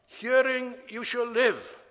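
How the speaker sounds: preacher's rising emphasis; emphatic. Real, not acted. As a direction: ring out Hearing as the key word. Climax of the repeated-verb examples.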